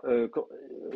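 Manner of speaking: normal talking voice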